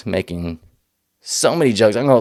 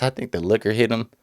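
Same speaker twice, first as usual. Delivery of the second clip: ordinary talk, nothing marked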